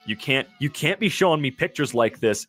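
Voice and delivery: ordinary talk, nothing marked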